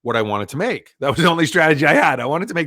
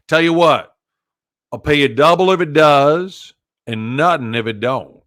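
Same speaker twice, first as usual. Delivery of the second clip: loud imitation of a tough Southern gent; put-on Southern gentleman voice; 6 dB louder than usual. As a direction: play an old Southern boss laying down a deal. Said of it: "it was a Southern gentleman".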